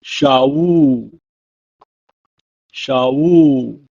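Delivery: Shaul said "shah-ool" twice; "Shaul" said twice, falling; Hebrew word. Shown as a demonstration. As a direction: model "Shaul" then repeat. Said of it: "It's pronounced in Hebrew as".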